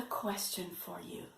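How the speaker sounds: unvoiced secret whisper. Teacher whispering a question to the puppet.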